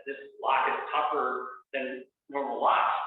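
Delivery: ordinary talk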